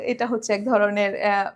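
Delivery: ordinary talk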